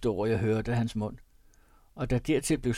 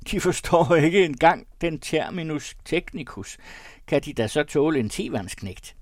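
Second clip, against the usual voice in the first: put-on hearty old pastor welcoming guests; a lightly voiced merry parson; jovial country pastor; 5 semitones higher, 5 dB louder than usual. A narrator's reading. Pastor welcoming the Copenhagen guest, hearty.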